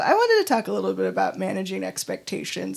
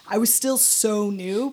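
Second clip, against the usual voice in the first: usual speaking voice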